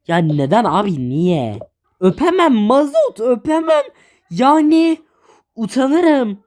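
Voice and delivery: usual speaking voice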